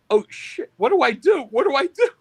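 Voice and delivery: high, loud, flustered retelling; mock panic. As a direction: act out a rising panic. Retelling his panic on the radio call.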